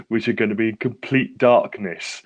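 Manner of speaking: ordinary talk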